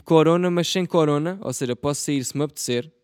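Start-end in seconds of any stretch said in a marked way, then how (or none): 0.0-1.4 much louder, insisting; emphatic. Real, not acted. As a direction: hammer the point home. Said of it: "repeats the idea louder".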